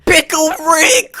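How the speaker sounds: yelling. Retold or imitated. A fan having a spasm on the McDonald's floor, screaming. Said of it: "floor yelling".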